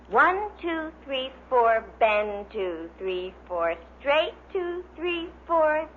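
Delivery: calling out the counts to a class; peppy fitness instructor counting reps; exercise class count. Acted. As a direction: lead the ladies through their bends. Exercise show counting drill.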